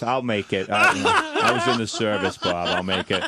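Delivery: lightly voiced stoic dad; a hint of a stubborn old serviceman; dad impression. Dad brushing off his son's worry, lightly imitated.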